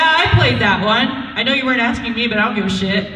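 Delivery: usual speaking voice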